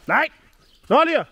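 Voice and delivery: sudden loud, high yell; startled shout. Real, not acted. Rider shouting at the bolting horse, a startled yell. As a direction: snap out in alarm as the horse bolts. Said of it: "bağırdık biraz".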